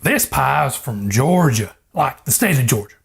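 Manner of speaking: ordinary talk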